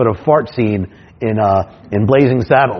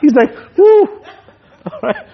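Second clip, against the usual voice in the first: high laughing, breaking up; cracking up high and giddy; can't stop laughing at the memory; 10 semitones higher, slower than usual. Real, not acted. Laughing at the menu guy.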